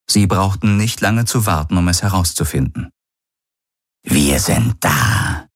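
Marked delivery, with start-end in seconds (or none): 4.0-5.5 low, slow, cold alien voice; low, measured voice of a bound alien who knows something; alien prisoner. Acted. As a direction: speak slowly from deep down, menacing and calm. Alien prisoner Gaurus speaks.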